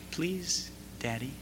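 put-on tiny, soft child's please; little soft voice. Toddler finally gives in to daddy, soft and small. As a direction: softly imitate a small child giving in. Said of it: "this little soft voice".

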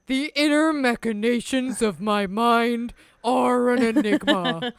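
slow, dopey Patrick voice; Patrick impression. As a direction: drag it out like dim Patrick Star. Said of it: "exactly how Patrick says it".

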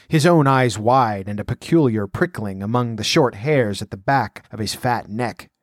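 usual speaking voice

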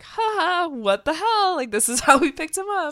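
high, nervous fake laugh; awkward fake cheer. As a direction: friend mimics the parents' uneasy cheer, high and forced. Niles and Daphne awkward.

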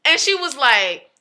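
normal talking voice

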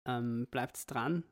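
ordinary talk

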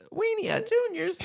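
very high put-on voice; quoted cartoon character. Quoting the bouncer from SpongeBob, very high voice. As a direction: pitch it up to a cartoon squeak. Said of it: "He said".

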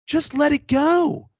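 high, loud, slow exasperated plea; exasperated, begging her to just let it go; high loud slow exasperation; exasperated outburst. Retold or imitated. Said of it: "I'm like".

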